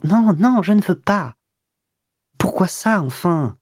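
high, insistent stubborn no; stubborn high-pitched refusal. A narrator's reading. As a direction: dig in and refuse, pitch jumping up. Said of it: "elle refusait toujours".

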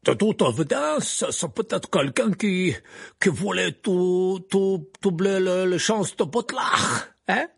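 slow, high, halting foreign lilt; a grand lady groping for words; Madame Maxime. A narrator's reading. Madame Maxime avance son idée. (Madame Maxime, voix haute et hésitante)

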